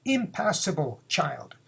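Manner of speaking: normal talking voice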